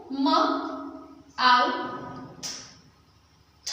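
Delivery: म, आउ, थ repeated for the class; sounding out again. Shown as a demonstration. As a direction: repeat the sounds of माउथ for listeners. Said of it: "फिर से सुनो".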